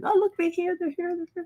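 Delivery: high excited peeking voice. Retold or imitated. Peeking through curtains, she squeals that her parents have arrived.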